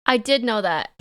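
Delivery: ordinary talk, nothing marked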